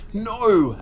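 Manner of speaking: high, loud wail of terror; terrified wail. A narrator's reading. Boy wails as the gargoyle claws him, high and loud. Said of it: "uttered a terrified wail".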